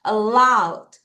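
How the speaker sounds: "Allowed" stressed on LOWED; light first vowel, then long falling LOWED in "Allowed"; stress demonstration. Shown as a demonstration. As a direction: punch the second syllable of "Allowed". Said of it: "여기서 강세예요".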